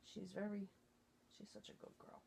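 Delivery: breathy whisper of affection for a pet; whispered praise. Real, not acted. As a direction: whisper it to the dog. Praising her dog nearby.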